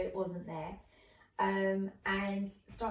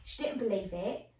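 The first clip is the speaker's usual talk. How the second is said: ordinary talk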